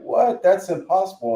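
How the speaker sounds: high-pitched mock astonishment; imitated disbelief. Lady hearing the drone's price, imitated high disbelief. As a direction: play the lady's high, incredulous reaction.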